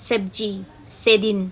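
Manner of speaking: সবজি and সেদিন said with a tongue-to-teeth s; the mispronounced s that she warns about; showing the faulty s sound; wrong s sound. Shown as a demonstration. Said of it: "ভুল উচ্চারণ করতে".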